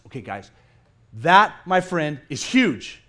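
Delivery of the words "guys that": ordinary talk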